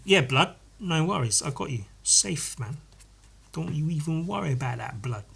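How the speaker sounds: lightly put-on British street slang accent; easygoing street-slang accent, softly thrown off; colloquial slang affect. Answering someone in the shoe store, colloquial street accent. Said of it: "taken on that affect".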